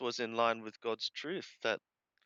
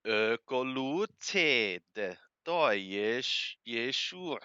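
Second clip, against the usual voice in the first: slow, louder, measured reading of the Greek text; scripture reading; 3 semitones higher, 6 dB louder, slower than usual. Acted. Teacher reading John aloud to the class, slow and measured. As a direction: read each phrase aloud slowly as holy text.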